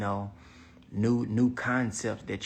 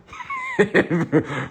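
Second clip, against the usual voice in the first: big hearty laugh, higher and louder; loud burst of laughter; 8 semitones higher, 8 dB louder than usual. Real, not acted. Cracking up at his honest answer, laughing loudly. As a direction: let out a real belly laugh.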